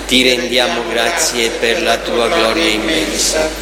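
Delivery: ordinary delivery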